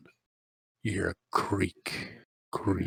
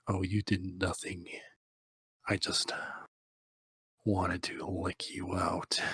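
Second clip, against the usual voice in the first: creepy soft whisper; 5 dB quieter than usual. Retold or imitated. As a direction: murmur it like a creep. Jackson's creepy reply.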